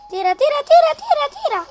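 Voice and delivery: high, loud building chant; frantic shouting. Real, not acted. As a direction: yell at the player to shoot. Urging a shot.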